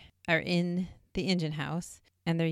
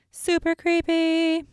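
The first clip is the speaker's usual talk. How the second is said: spooked; 12 semitones higher, 6 dB louder, slower than usual. Real, not acted. Reacting to spooky event.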